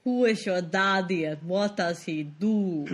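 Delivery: put-on slow, heavy Austrian-accented line; slow Arnold accent. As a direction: drag out each word like Arnold in Kindergarten Cop. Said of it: "You're talking very slowly".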